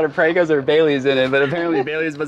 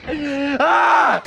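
mock scare shout; 13 semitones higher than usual. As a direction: yell to make them jump.

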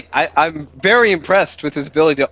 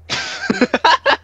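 high laugh. Real, not acted. Laughing at the jump.

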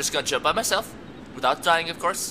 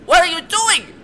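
high, loud exasperated yell; exasperated shout; 9 dB louder than usual. Real, not acted. Gamer yelling at a companion who won't follow, exasperated.

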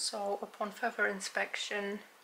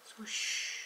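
hushed unvoiced whisper. Real, not acted. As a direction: whisper so mom stays asleep. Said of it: "My mom is sleeping".

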